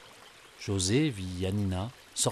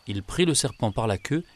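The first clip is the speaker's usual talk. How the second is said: plain reading voice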